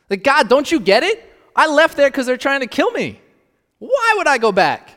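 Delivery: high, loud incredulous protest; protesting Elijah. Acted. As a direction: argue back at God in disbelief.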